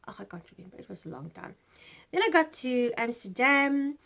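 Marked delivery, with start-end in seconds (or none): 0.1-1.5 breathy whisper to herself; whispered aside. Real, not acted. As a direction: mutter it off, giving up on the detail. Muttered aside while recalling flight length.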